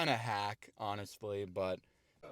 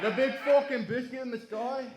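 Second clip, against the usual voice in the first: much higher, louder mock British accent with a laugh, rising on sky; hammed-up British accent; 11 semitones higher, 4 dB louder than usual. Comedian hamming up a Brit in his joke, loud British accent. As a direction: push the British accent over the top, laughing. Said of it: "That's my British accent".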